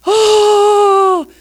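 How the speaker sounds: high, slow, stricken cry of dismay; mock shock, drawn out and breaking high; imitating shocked friends. Friends reacting to her abuse story, in stricken dismay. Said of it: "friends of mine bawl their heads off and go".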